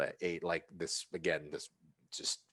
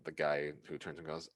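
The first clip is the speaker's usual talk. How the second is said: normal talking voice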